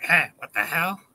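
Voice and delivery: lightly voiced startled dog; a hint of a confused dog; dog's inner voice. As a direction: give the dog a puzzled line. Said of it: "He's like".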